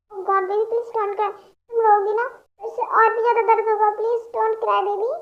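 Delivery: plain reading voice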